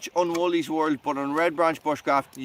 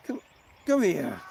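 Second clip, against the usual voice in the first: usual speaking voice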